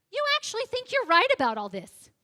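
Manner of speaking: mock his disbelief; disbelieving man scoffing at her view; incredulous challenge.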